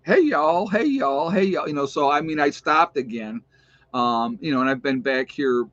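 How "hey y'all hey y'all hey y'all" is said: higher, louder mock greeting; imitated sisterly teasing. Sister giving her brother the business over his Southern habit, mock teasing. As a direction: tease with a repeated, sing-out greeting. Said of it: "just gave me the business".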